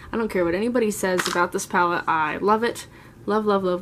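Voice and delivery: ordinary talk, nothing marked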